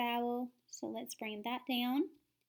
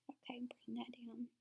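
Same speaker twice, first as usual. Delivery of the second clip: whisper; 10 dB quieter than usual. Real, not acted. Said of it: "self-talk aside".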